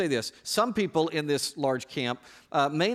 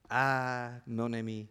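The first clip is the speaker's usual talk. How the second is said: low, hammed-up French accent; awful French accent; 3 semitones lower, 3 dB quieter, slower than usual. Preacher teasing the congregation as a Frenchman, mock French accent. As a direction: sigh it out in a bad French accent. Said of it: "in your awful French accent".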